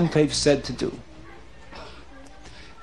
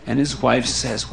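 usual speaking voice

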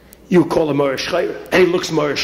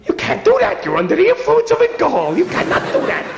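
high, shrill-pitched alarmed protest; deputy protesting the threat to his title; panicked protest; 13 semitones higher than usual. Acted. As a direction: squeal in outraged panic. Shmuel panicking at losing title.

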